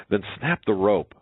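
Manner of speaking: put-on story girl giving an order; urging. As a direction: urge him on firmly. Girl urges the elephant.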